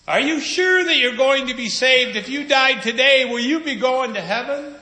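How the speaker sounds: mock evangelist calling after him down the midway; pushy evangelist. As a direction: hammer him with salvation like a street preacher. Evangelist chasing him, retold.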